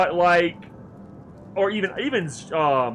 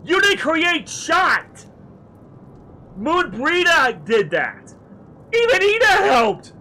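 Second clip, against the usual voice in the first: much louder, higher, slowed furious yelling; yelling at a useless ally who took credit; furious shout; 10 semitones higher, 7 dB louder than usual. Acted. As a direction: hammer each word in outrage. Player rages at the character.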